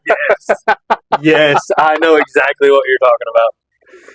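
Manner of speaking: high, slow and drawn out; excited recognition. Real, not acted. Host recognizing his friend's story, eager and amused. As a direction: lit up, knowingly amused.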